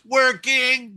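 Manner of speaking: monotone robotic computer voice; flat ship's computer read. Retold or imitated. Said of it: "this monotone female voice".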